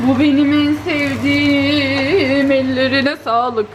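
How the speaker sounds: excited gasp, then slow and loud; delighted. Real, not acted. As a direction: gasp with delight, savour each word. Sees favourite börek.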